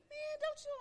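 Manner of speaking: ordinary delivery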